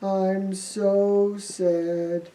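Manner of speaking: very slow, soft, low moan; mopey Thomas. Acted. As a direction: drag out the sadness in a sulk. Thomas moping.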